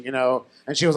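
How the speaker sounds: ordinary talk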